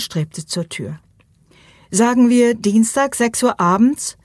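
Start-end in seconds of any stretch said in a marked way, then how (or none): 1.9-4.2 higher, louder call after someone leaving; raised voice calling across the room; calling after her. A narrator's reading. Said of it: "rief er ihr nach".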